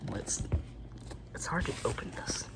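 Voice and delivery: whispering. Real, not acted.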